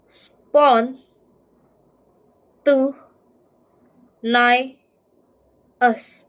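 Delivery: "Spont. T. Nai. Uh." as isolated beats with long pauses; syllable by syllable. Shown as a demonstration. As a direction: sound out "Spon", "Tu", "Nai", "Us" one at a time. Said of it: "How can we read?".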